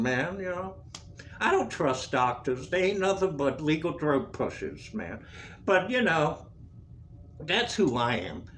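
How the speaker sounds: ordinary delivery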